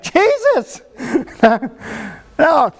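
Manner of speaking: a half-laughing shout to someone far off; crying out. Retold or imitated. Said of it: "is crying out".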